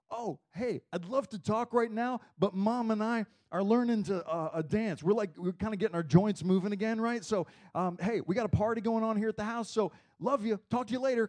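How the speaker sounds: acted upbeat brush-off on a call; cheerful parent on the phone. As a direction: play a parent too happily busy to talk.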